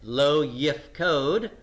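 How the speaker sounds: Lo yifkod, each syllable drawn out slowly; slow Hebrew demo. Shown as a demonstration. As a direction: say Lo yifkod slowly and clearly for students. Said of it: "he will not appoint".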